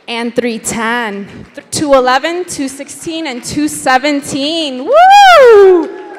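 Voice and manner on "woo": big high shouted woo; loud excited whoop. Real, not acted. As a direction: let out a triumphant yell. Auction ends in celebration.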